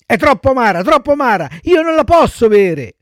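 put-on high, loud little-boy refusal; whining child puppet. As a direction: protest like a fussy child. Pinocchio refuses the bitter medicine. (burattino lamentoso, voce acuta)

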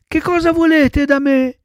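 put-on high, scared little-boy voice; frightened cry. A narrator's reading. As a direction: call out in childish alarm. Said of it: "gridò Pinocchio, rizzandosi tutto impaurito". (grido impaurito, voce acuta)

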